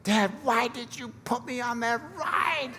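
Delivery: high, small child's voice, a hint of tears; scared little daughter. Daughter shaken at the bottom of the slide, high child's voice. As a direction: play the shaken five-year-old asking her father.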